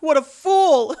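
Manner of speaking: high, loud jeer; hammed-up derisive hoot; mocking. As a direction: scoff like a snob poking fun. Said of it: "the media mocked and laughed".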